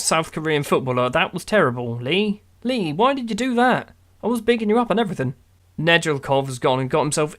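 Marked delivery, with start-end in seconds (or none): 2.0-5.3 much higher, whiny reproach; mock betrayed scolding. Real, not acted. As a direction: tell off a player who let you down. Scolding player after bad miss.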